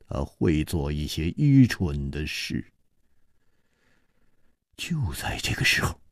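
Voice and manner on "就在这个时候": hushed whisper before the twist; suspense whisper. A narrator's reading. Narrator whispering just before the water vanishes. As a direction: breathe it to set up the surprise.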